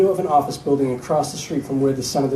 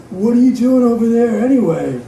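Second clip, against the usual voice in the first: high, loud drug-addled confusion; dazed and stoned, pitched way up; confused, drug-addled; 7 semitones higher, 5 dB louder than usual. Acted. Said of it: "in a confused, drug-addled tone".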